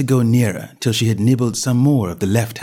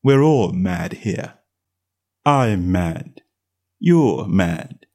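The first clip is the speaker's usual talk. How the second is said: lazy cat voice; 4 semitones lower, much slower than usual. A narrator's reading. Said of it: "said the cat".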